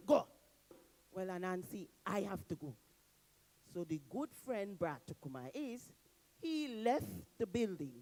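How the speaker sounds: plain reading voice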